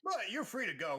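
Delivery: a lightly voiced haughty shopkeeper; mock dismissive. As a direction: shoo the customer out.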